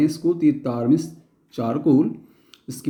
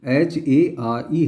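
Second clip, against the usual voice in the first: slow spelling out; much slower than usual. Shown as a demonstration. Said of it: "तो इसको अपन बोलते हैं hair".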